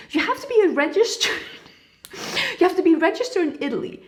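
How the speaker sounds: high, quick bank-clerk imitation, breaking into a laugh; hammed-up bureaucratic insistence; mock officious clerk. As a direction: imitate a fussy clerk at high pitch and speed. Mocking retold bank clerk's demand.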